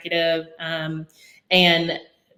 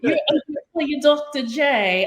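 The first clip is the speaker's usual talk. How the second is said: higher, slow, drawn-out Australian boss; Australian accent; 8 semitones higher, slower than usual. Retold or imitated. Boss from Australia coins the nickname, mock Australian accent. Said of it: "I love this accent".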